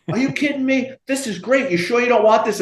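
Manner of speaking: imitating the delighted ASPCA cop; thrilled. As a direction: gush like he hit the jackpot. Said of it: "you would have thought I handed him".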